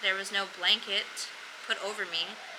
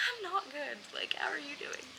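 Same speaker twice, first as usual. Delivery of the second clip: whisper; 7 semitones higher, 6 dB quieter than usual. Retold or imitated. Sick patient answering the doctor.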